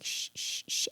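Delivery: soft voiceless hushing; gentle imitated shushes; shushing. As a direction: hush a crying baby. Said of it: "I was, like, shushing him".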